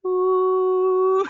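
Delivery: high, loud, drawn-out "ooh"; weird zombie moan. Retold or imitated. Podcaster imitating Sean's mum playing zombie, weird high moan. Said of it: "doing a weird sort of".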